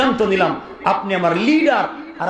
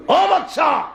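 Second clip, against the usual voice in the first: high, ringing shout; sudden raised preacher's cry; calling out; 11 semitones higher, much slower than usual. Acted. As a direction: call out sharply to a king. God addresses the king, voiced.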